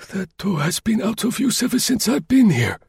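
put-on high old voice, taken aback; old concierge muttering in surprise; astonished old man. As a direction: puzzle over it like a startled old man. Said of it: "he muttered".

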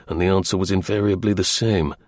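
ordinary delivery, nothing marked

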